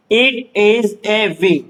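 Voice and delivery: very slow, word by word; drawn-out words, evenly spaced; slow reading drill. Shown as a demonstration. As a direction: model the sentence for beginners, slowly. Teacher models sentence to learners.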